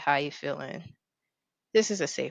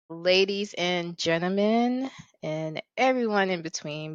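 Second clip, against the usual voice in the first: ordinary delivery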